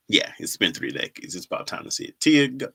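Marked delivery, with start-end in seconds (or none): none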